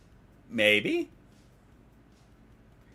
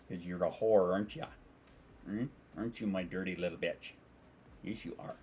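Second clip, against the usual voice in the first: soft, lower teasing murmur; cooing to pet; 3 semitones lower, 7 dB quieter than usual. Real, not acted. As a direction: tease the cat tenderly, dropping to near-whisper at the end. Cooing teasingly at her cat.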